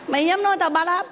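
high, offended retort over a mangled name; a man bristling that his name was twisted; indignant man. Acted.

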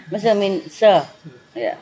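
ordinary talk

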